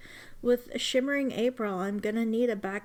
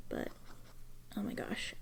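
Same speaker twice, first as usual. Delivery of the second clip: awed whisper; 2 semitones lower, 11 dB quieter than usual. Real, not acted. Overjoyed at the pen.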